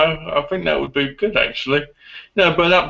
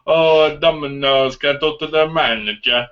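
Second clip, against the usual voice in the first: mock Italian accent. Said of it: "Makes me wanna call Domino's up and go".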